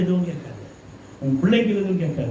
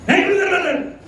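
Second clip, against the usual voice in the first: high, sharp cry to let go; squealing stop it; acted quarrel shout; 13 semitones higher than usual. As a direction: shriek at the husband to stop. Acted wife in family quarrel.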